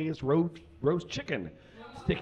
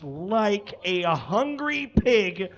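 louder, higher as the laugh breaks through; losing it mid-sentence; cracking up; 7 semitones higher, 6 dB louder, much slower than usual. Real, not acted. Reading absurd simile, cracking up.

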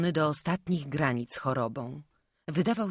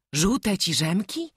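surprised question; 5 semitones higher, 6 dB louder, slower than usual. A narrator's reading. Prince repeats, surprised.

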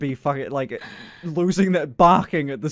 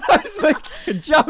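flustered laugh; 12 semitones higher, 3 dB louder than usual. Real, not acted.

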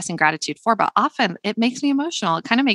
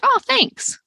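offhand thanks. Shown as a demonstration. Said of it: "instead of just being like".

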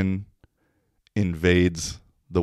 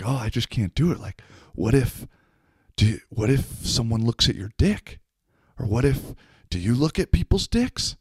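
a bit higher, anxious imitation; mimicking the guy; 4 semitones higher than usual. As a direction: play the shy guy worrying, pitched up. Guy at urinal quoted.

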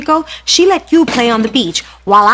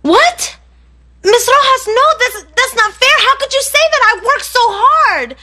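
higher, alarmed pleading; shocked protest; 10 semitones higher than usual. Real, not acted. Student protesting a teacher's harsh news, alarmed and pleading. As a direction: protest the unfairness in a panic.

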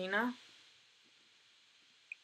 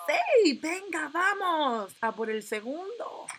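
cheering; 10 semitones higher, 5 dB louder, slower than usual. Real, not acted. Gamer cheers second baby.

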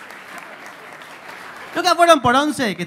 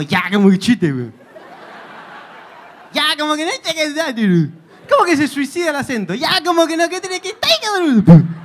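exaggerated Chilean lilt that climbs higher, ending in a loud burst; mock the rising and falling Chilean melody; Chilean accent; 4 semitones higher, 4 dB louder than usual. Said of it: "Es como que sube y baja". (acento chileno exagerado que sube y baja)

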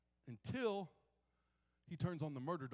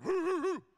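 loud high mock barking, roo roo roo; a big dog barking at a stranger; imitated dog barking; 12 semitones higher, 12 dB louder than usual. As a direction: bark it out like a guard dog at the door. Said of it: "and then he's like".

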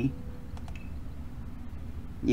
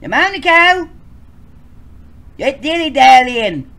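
squeaky high voice; 14 dB louder, slower than usual. Acted. High character voice calling.

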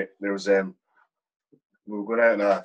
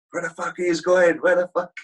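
mock officer's yell, much higher and louder; imitating yelling officer; 10 semitones higher, 5 dB louder than usual. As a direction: bellow it like the boss caught you sneaking off. Commander stops car, draws pistol.

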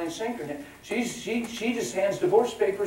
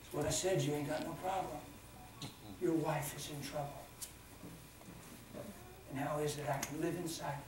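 God calmly answering the screaming man, softer; 14 dB quieter than usual. Retold or imitated. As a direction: answer gently and steadily. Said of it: "the Lord calmly said".

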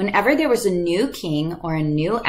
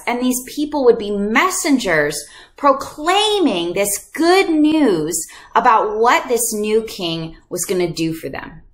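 usual speaking voice

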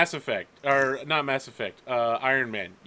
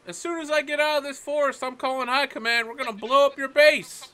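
fast, higher, threatening cadet; 8 semitones higher than usual. Acted. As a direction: threaten to blow up the base. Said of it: "So he's like".

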